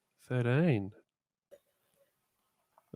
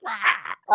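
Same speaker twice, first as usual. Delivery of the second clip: mock wail; 11 semitones higher, 7 dB louder than usual. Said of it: "I'd be like".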